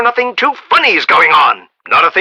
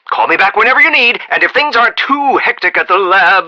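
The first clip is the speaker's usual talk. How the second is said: ordinary talk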